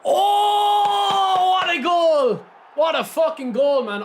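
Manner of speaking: high, loud goal celebration; excited shout. Real, not acted. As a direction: yell it out in joy.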